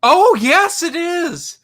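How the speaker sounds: high, loud delighted exclamation; real excited recognition. Real, not acted. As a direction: burst out as you suddenly spot it. Recognizes the Predator costume.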